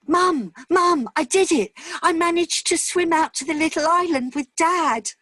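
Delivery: put-on high, loud, thrilled little girl calling Mum; a young daughter bursting with pride, calling to her mum; excited child. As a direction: shout it out like a proud kid who just swam to the island.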